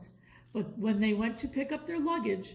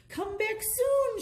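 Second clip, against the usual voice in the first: high, loud, slow call; crowd shout; 12 semitones higher, 4 dB louder, slower than usual. A narrator's reading. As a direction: call out after them from the street. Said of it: "shouted the crowd".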